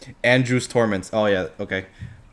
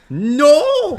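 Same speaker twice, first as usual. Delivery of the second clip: horrified no; 13 semitones higher, 4 dB louder than usual. Real, not acted. Reacting in horror to story.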